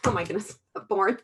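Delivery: words breaking into real laughter; laughing at a slip. Real, not acted. Hostess to her team, laughing at her own slip of the tongue.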